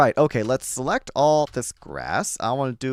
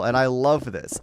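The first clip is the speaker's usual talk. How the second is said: ordinary talk, nothing marked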